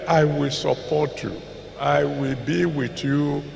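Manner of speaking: ordinary talk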